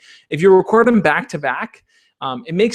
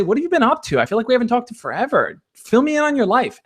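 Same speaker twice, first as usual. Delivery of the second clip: bright and friendly; 7 semitones higher than usual. Shown as a demonstration. Sample friendly message to crush.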